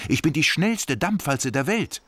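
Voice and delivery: high, proud little steamroller; boasting steamroller; Buster's boastful voice. Acted.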